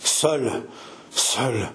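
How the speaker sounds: halting single words, with pauses; terrified stammer. A narrator's reading. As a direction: falter on the word, stunned. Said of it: "Elle bégaya".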